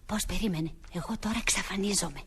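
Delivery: low, very soft whisper; whispered aside. Acted. Maid alone, about to slip away and hide, whispered aside. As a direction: say it secretly under your breath. Maid's aside before vanishing.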